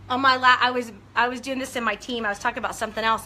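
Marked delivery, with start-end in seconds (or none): none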